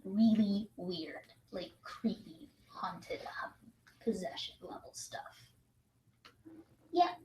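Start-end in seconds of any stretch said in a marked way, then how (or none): 0.1-5.5 creepy whisper. Pretend. Creepy haunted hum of character.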